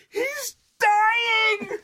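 high, loud, crying shout; panicked shout. A narrator's reading. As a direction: scream it in terror. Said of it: "she shouted".

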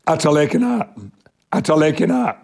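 "Ata lekin at" in broad Yorkshire dialect, run together. Shown as a demonstration. [name] calling at a friend's house in broad Yorkshire dialect. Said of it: "I spoke in dialect".

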